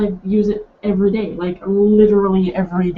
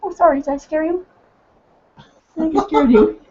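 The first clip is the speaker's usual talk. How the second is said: high, gentle apology to a bird; soothing the pet; 5 semitones higher than usual. Real, not acted. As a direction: reassure the startled pet.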